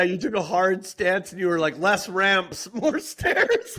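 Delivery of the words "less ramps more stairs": mock quote laughing. Mocking friend's Reddit stance.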